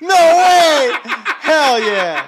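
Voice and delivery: very high, loud laughing cheer; delighted shout. Real, not acted. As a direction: explode with real delighted disbelief. Listener bursts out delighted at story.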